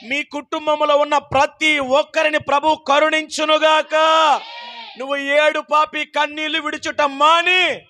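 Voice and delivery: preaching benediction; fervent blessing. Acted. Preacher blesses families, fervent preaching. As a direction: pronounce each blessing with conviction.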